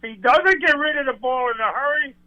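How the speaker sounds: ordinary talk, nothing marked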